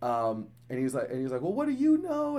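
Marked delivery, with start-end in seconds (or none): 1.4-2.4 high-pitched mock yell of a kid; imitating defiant kid. Kid talking back during dental visit, high mock defiance. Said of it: "screaming at his mom".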